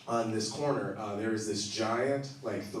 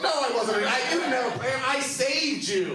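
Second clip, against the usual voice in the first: high, loud, slowing into laughter; laughing exasperation; 5 dB louder than usual. Retold or imitated. Telling Lucas he was no Uber driver, laughing and high. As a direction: laugh while insisting.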